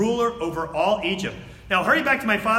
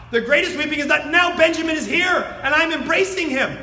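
louder, higher proclamation; fervent sermon climax; preaching shout; 7 semitones higher, 4 dB louder than usual. Real, not acted. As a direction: drive the point home at full voice.

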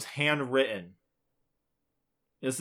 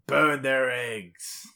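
slow deadpan; much slower than usual. Acted. Reading running-gag option.

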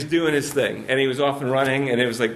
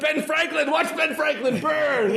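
much higher, slow, sing-song mock voice; mock gleeful money burner; 11 semitones higher than usual. Mocking a producer watching the money burn, high slow glee. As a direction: draw it out like a cartoon villain watching cash burn.

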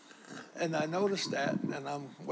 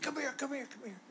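higher, quick beckoning repeated; eager call; 11 semitones higher, 6 dB quieter than usual. Retold or imitated. Cheerful counter kid calling the customer over, eager. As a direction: imitate a smiling kid waving you over.

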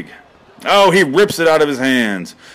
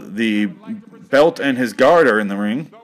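usual speaking voice

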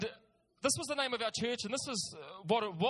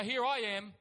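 usual speaking voice

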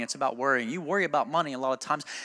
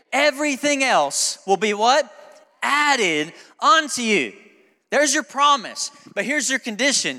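high, loud, slow preaching; fiery preacher lifting the promise to the crowd; preaching at full force; 8 semitones higher, 6 dB louder, slower than usual. Acted. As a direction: draw out "Added unto you" like a climax. Preaching scripture call and response.

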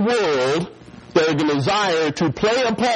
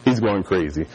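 usual speaking voice

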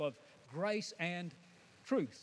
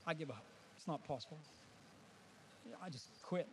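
defeated sigh; 4 semitones lower, 10 dB quieter, faster than usual. Retold or imitated. Voices a despairing listener.

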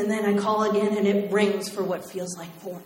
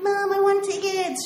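much higher whiny plea; pleading teen; 11 semitones higher than usual. Retold or imitated. Teen begging mom for concert tickets, whiny. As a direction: beg mom, high and whiny.

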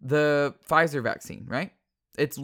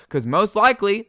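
normal talking voice

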